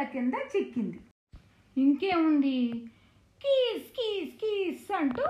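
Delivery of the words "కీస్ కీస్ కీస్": high squeaky mouse cries kees kees kees; mouse squeaking. Acted. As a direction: squeak it like a frightened little mouse. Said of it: "చిట్టెలుక అరవటం మొదలుపెట్టింది".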